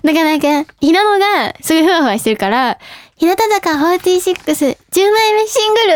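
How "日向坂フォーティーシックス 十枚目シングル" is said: high, sweet idol announcement; cute. Retold or imitated. Imitating a fluffy bandmate introducing the single, very cute. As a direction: announce it cutely, pitched up. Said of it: "すっごい可愛い感じで言ってんのに".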